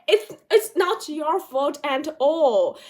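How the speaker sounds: ordinary delivery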